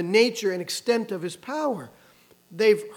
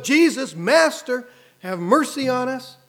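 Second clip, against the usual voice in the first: louder, higher mock shout for mercy; retold shout; 4 semitones higher, 5 dB louder than usual. Preacher voicing ten lepers hailing Jesus from afar, retold shout. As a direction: call out as the group, without full yelling. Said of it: "the shout goes up".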